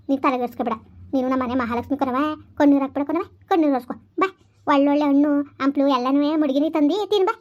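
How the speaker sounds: calm the crying bride down; mother-in-law comforting her pregnant daughter-in-law; soothing mother-in-law. Acted.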